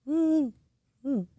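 a weak, half-hearted high woo; mock the crowd's feeble cheer; limp woo. Students answering the pastor with a limp mock woo. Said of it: "And it's like".

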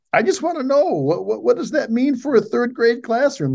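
high-pitched, plaintive mock questioner; imitated pleading question. Voices a teacher pleading for clarification.

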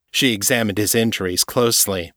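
ordinary delivery, nothing marked

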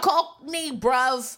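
hammed-up Cockney; Cockney accent. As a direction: put on a matey Cockney accent. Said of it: "I have a Cockney accent".

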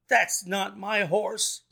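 high, slow cry of protest; indignant cry. A narrator's reading. Owner seeing a strange horse in his colors, crying out. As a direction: cry out in dismay, drawing it out. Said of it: "cried the owner".

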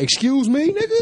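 very high, offended challenge; indignant exaggerated confrontation. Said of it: "gonna be like".

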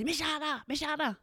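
put-on high, breathy child's plea; a hint of an excited kid; eager kid calling. Kid waving a hand at the teacher, lightly high.

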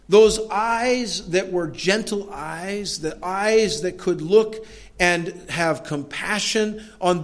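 high, slow rhetorical build; impassioned preaching. Real, not acted. Preacher recalling Christ's compassion for the afflicted, impassioned. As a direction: preach it as a rising litany, each phrase lifted.

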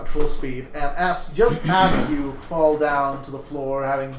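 slow, swelling game master narration; dramatic narration. Acted. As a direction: build it up slowly, epic scene-setting. GM narrates climax.

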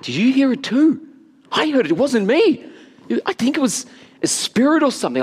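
high, urgent, startled retelling; acted excited alarm. Acts out startled talk with sons.

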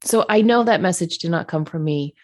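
ordinary delivery

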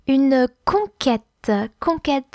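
"Une conquête" said slowly, in French; slow French model of "conquête"; slow French pronunciation. Shown as a demonstration. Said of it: "C O N Q U E".

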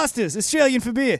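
mock Aussie beer-ad voice; hammed-up Australian slogan; Australian accent. Shown as a demonstration. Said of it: "Can you do an Australian accent".

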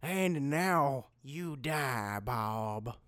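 slow low menacing threat; menacing villain. Acted. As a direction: threaten him slowly.

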